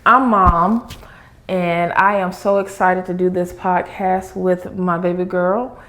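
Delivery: normal talking voice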